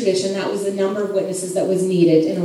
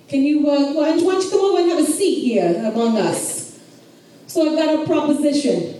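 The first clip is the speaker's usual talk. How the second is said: put-on New York accent; 5 semitones higher than usual. Boaz calling the nearer kinsman over, in a New York accent. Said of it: "he has a New York accent".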